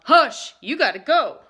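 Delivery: loud firm order; usher calling. A narrator's reading. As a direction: throw him out loudly. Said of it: "the usher called".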